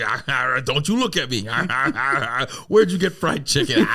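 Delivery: snarling, mock-feral guard over food; ravenous eating. Starving man guarding his fried chicken from the giver, ravenous. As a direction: chomp noisily between exclamations, raising the voice. Acts out himself devouring the chicken.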